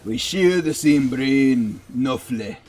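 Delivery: put-on slow, amateur Irish accent; loosely imitated Irish lilt. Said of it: "my Irish accent is not that bad".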